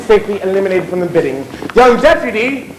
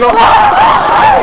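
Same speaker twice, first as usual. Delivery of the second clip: mocking shout; 4 dB louder than usual.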